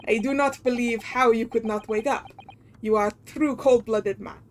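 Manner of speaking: put-on hammy Russian sailor accent; bad Russian accent. Sailor questioning a stowaway, bad Russian accent. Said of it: "horrendous Russian accent I'm gonna be putting on".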